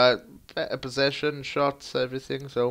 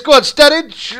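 much higher, louder yell; urgent shout; 13 semitones higher, 13 dB louder than usual. Real, not acted. Gamer screaming Sturridge on. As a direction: scream him on.

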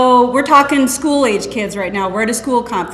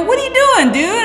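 sharp, much higher startled question; startled mom; 12 semitones higher than usual. Retold or imitated. Mother catching her son at the gun cabinet at night, startled. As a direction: catch him in the act, voice jumping up.

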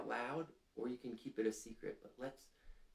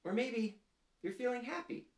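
much higher, bright and bouncy; cheerful high happy voice; 13 semitones higher, 3 dB louder than usual. Acted. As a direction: sound delighted for the kids. Naming happy feeling to kids.

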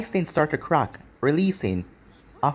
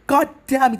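high, loud furious outburst; angry, high-pitched yell; scream; 10 semitones higher, 5 dB louder than usual. A narrator's reading. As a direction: scream it in frustration, voice jumping up. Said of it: "Tsunade screamed".

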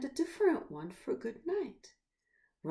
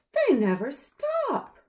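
worm; 8 semitones higher, 9 dB louder than usual. Acted. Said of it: "says the worm".

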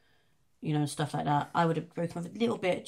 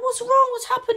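much higher, louder, anxious questions; panicking mum; 10 dB louder than usual. Retold or imitated. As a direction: demand what happened in a panic. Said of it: "You're panicking and shit like that".

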